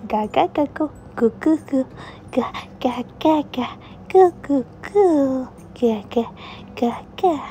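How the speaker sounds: put-on gurgling baby sounds; baby babble. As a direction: babble like a happy infant. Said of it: "And he said".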